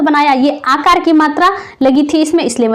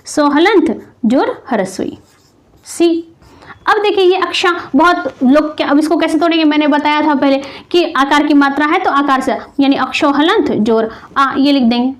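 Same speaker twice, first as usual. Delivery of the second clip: usual speaking voice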